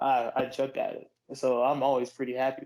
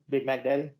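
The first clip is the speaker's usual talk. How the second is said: normal talking voice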